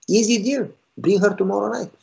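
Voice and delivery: high, casual no-problem tone; breezy. Retold or imitated. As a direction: toss it off as nothing. Pastor brushes off an easy problem.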